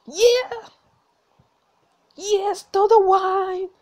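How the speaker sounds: high loud slow cheering; cheering her on like at a match; excited cheer. Real, not acted. Cheering her character's rebellion.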